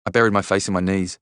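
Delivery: ordinary delivery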